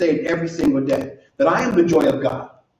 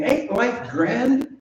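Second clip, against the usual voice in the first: slow, relishing ain't life grand; leisurely delighted rhetorical question; savoring quote; 6 semitones higher, much slower than usual. Retold or imitated. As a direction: draw it out as if tasting it. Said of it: "I love this saying".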